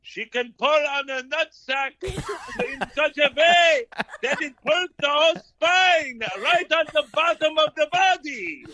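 high voice breaking into laughter; can barely get it out for giggling; laughing while talking. Real, not acted. Cracking up while describing it to friends, laughing. Laughing through a gross joke.

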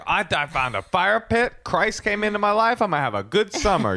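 high-pitched, hammed-up optimism; mock upbeat enthusiasm. Acting out own upbeat self.

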